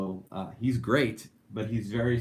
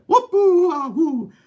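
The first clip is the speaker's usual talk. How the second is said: high, loud, slow video game yell; a stretched cartoon jump cry; Mario whoop; 11 dB louder, much slower than usual. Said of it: "I don't know what that was".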